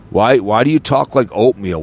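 ordinary delivery

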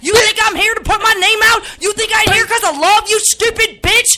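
a roasting yelled at a rude guest; angry shout. Acted. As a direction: tear into them, shouting. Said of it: "This is a real live snap".

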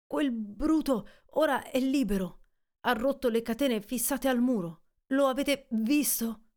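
a man shaken by bad news; Montgomery warning that the beast is loose; alarmed Montgomery.

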